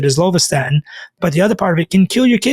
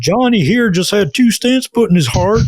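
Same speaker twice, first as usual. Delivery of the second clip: slow, higher-pitched mock accent of the doctor; mock Ken Berry accent; 4 semitones higher than usual. Keto doctor announcing a patient's stents, in a mock accent. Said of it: "not trying to make fun of his accent".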